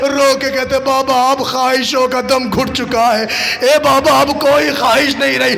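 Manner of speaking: high, loud grieving chant of the tale; wailing lament recitation. Acted. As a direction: cry the scene out to the mourners. Father's plea in lament. (ऊँची आवाज़ में मातमी मरसिया ख़्वानी)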